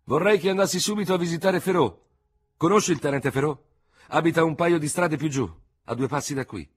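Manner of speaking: loud, high-pitched urgent request; calling up. A narrator's reading. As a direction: call up to someone at a window. Dubert calls up to surgeon at window.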